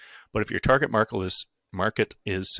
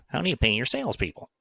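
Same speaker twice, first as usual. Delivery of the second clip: higher-pitched mocking imitation of a colleague; put-on higher voice for a colleague's question; Tony impression; 5 semitones higher than usual. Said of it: "that was my Tony voice".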